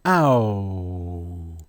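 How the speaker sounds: Ow drawn out and falling; sustained Ow gliding into "oo"; demonstrated "ow" sound. Shown as a demonstration. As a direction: lead the class in a long Ow. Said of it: "Say it with me again".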